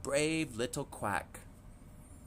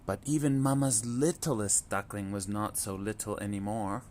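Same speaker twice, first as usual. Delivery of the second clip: usual speaking voice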